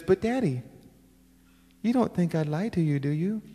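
put-on soft, high kid voice, rising at the end; little girl pleading. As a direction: plead sweetly like a child to daddy. Retelling his little daughter's pleading.

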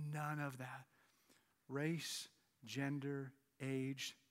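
low, soft voice with weighty pauses; quiet, deliberate sermon delivery; solemn hushed preaching. Real, not acted. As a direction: bring the congregation down to a grave hush. Preacher drops to solemn hush.

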